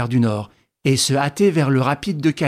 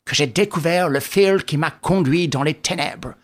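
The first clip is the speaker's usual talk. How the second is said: usual speaking voice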